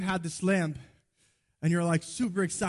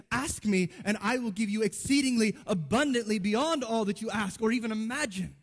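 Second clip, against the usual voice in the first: higher, swelling preacher's declaration; emphatic divine promise; 4 semitones higher than usual. Acted. Preacher voices God's promise to his children, emphatic proclamation.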